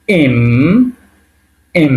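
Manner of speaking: "এম" with a held Bengali ম sound; "এম" ending on a long hum; letter-name demo. Shown as a demonstration. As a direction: say "এম", drawing out the closing m. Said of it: "উচ্চারণ হবে ময়ের মতো".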